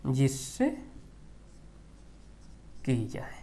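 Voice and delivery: quiet dictation, trailing off; slow dictation repeat. Shown as a demonstration. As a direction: repeat slowly so students can write. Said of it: "उपमान मतलब".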